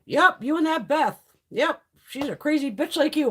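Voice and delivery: high, emphatic mom impression; imitated teasing mom. Mom likening daughter to Beth, imitated teasing. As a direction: needle her as a knowing mother.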